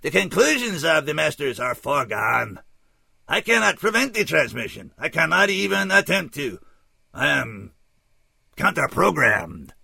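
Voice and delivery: put-on high, loud, oddly accented alien; measured, detached alien, pitched well up; dispassionate alien emissary. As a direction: report it formally in a stilted alien voice. Voices the alien stranger.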